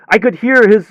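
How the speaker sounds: ordinary talk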